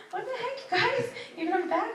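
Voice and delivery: delighted disbelief; surprised family greeting them; laughing surprise. Retold or imitated. Family surprised at their return.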